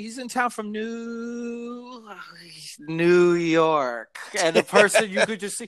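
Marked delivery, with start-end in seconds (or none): 0.0-4.2 slow, dragged-out 'New... York'; imitating a friend dragging out the city name; drawn-out introduction. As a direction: stretch NEW out very long before landing on York.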